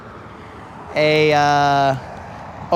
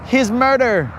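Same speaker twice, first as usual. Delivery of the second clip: higher-pitched, drawn-out mock amazement; imitated grateful fiancée; 6 semitones higher, slower than usual. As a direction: play the fiancée, pitched up and slow. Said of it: "And then she'll be like".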